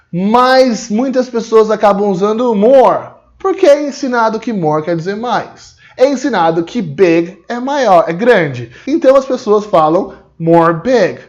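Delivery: ordinary talk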